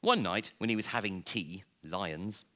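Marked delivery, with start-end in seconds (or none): none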